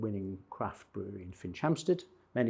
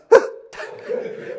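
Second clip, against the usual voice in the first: shocked gasp; 11 dB louder than usual. Retold or imitated. Karen spots naked man on video.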